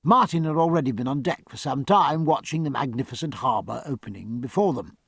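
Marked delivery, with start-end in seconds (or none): none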